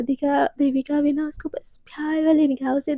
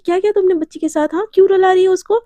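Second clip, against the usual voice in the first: quick, louder aunt's telling-off; acted scolding; 4 semitones higher, 5 dB louder, faster than usual. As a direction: scold fast, demanding an answer. Aunt scolds over crying girl.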